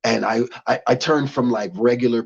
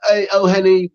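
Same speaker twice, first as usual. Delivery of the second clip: British greeting; 6 semitones higher, 5 dB louder than usual. Retold or imitated. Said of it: "sounds like a British rapper".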